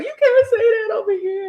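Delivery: high, loud, laughing; mock scolding. People telling her she can't say that, laughing mimicry.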